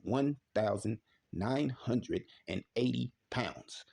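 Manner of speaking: slow, word-by-word emphasis. Shown as a demonstration. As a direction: spell out the figure slowly, word by word. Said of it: "Let me say that again slowly".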